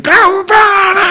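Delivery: very high screaming cheer; re-enacted frenzied crowd yell; wild ecstatic shout. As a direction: burst in shrieking with joy. Savages bursting into temple ceremony.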